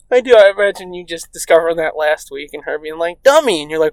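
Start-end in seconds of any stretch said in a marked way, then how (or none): 3.2-3.6 higher, teasing jab of a wife; mock scolding. Wife to clueless husband, mock scolding. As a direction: imitate an exasperated wife.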